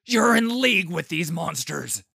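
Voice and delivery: furious accusation. Acted. Adamar shielding the survivors, shouting in fury. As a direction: hurl the accusation at him. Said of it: "His eyes hardened with fury".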